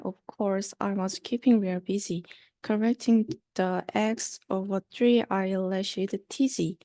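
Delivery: usual speaking voice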